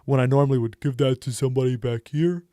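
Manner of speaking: character voice placed forward in the mouth. Shown as a demonstration. Game master demos an Earth Genasi voice, forward in the mouth. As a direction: push the words to the front of the mouth. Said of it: "a little bit more forward in the mouth".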